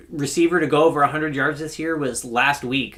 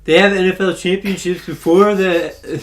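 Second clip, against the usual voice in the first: raised, insistent voice; loud objection; 5 semitones higher, 7 dB louder than usual. Real, not acted. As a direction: push back hard and loud. Heated football debate rebuttal.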